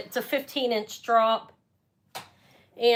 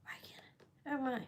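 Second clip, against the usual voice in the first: whispering; 3 semitones higher, 15 dB quieter than usual. Real, not acted. Searching for a missing item.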